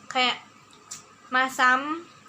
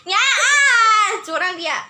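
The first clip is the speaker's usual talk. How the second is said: laughing protest; 8 semitones higher, 6 dB louder, slower than usual. Real, not acted.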